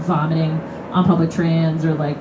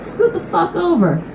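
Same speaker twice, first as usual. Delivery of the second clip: high, bossy mock demand; sassy demand; 10 semitones higher than usual. Woman ordering the seat hog to move, sassy demand. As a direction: order him to move over.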